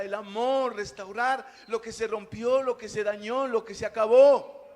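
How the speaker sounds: loud, slow preaching, hammering each phrase; full-force preaching. Acted. Pastor exhorting the congregation on prayer, loud full-force preaching. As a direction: preach it out to the whole church, building.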